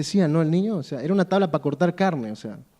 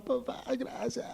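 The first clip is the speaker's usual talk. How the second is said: breathless tearful whisper to dad; whispered thanks; 5 semitones higher, 13 dB quieter, slower than usual. Retold or imitated. Boy thanking his father through tears, whispering. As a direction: whisper your thanks, choked up. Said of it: "Y llora".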